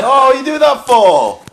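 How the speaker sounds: very high, loud, drawn-out mock outcry; exaggerated high-pitched disbelief, mimicking others; incredulous onlookers. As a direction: imitate people aghast, stretched out and shouted. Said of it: "People kinda look to me like".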